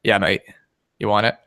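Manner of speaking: clumsy attempt at a British accent; bad British accent. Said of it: "he's got a really cool accent".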